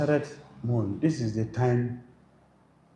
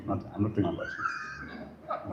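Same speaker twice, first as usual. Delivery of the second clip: laughing aside; 8 dB quieter than usual. Real, not acted.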